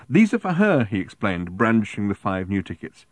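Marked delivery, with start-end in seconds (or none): 0.1-0.8 loud, high, urgent explanation; near yell. A narrator's reading. Arthur fending off the seller, nearly yelling. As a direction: blurt it loudly, pitch up. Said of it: "Arthur nearly yelled".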